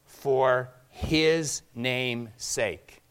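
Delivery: "for his name's sake" drawn out very slowly, each word weighted; slow emphasis. Real, not acted. Key phrase of the sermon.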